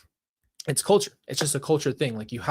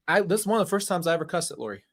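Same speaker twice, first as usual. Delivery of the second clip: ordinary talk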